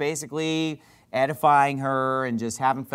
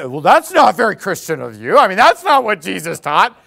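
loud, sneering imitation of a preachy atheist; mock the atheist's scolding tone, louder; 8 dB louder than usual. Preacher mimicking atheists who lecture Christians, mocking and loud. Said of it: "have we had atheists tell us".

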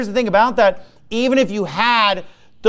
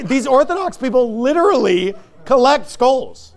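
ordinary delivery

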